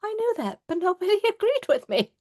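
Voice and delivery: finally someone believes you, pitch soaring; relieved excitement. Retold or imitated. Patient thanking the doctor who believed her, high and relieved.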